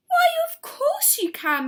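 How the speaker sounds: very high, eager cry; delighted cry. A narrator's reading. Missus Silver inviting Mister Hoppy down, delighted. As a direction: welcome him gladly. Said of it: "Missus Silver cried".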